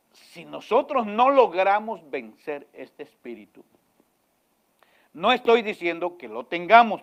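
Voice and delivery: loud, rising pulpit warning; fiery preaching. Acted. Pastor warns the congregation about hypocrisy, loud and rising. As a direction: drive the warning home, building.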